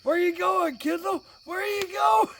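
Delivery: high, loud hammed-up holler; mock calling out. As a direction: shout teasingly after a runaway kid. Dad chases daughter down the aisle.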